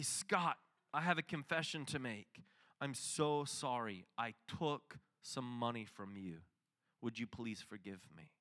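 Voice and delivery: hushed, guilty confession to a friend; remorseful confession. Retold or imitated. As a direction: own up softly, almost ashamed.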